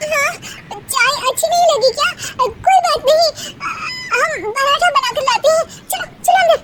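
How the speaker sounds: high, fast nervous chatter; flustered daughter-in-law. Acted. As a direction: cover up the bad tea brightly.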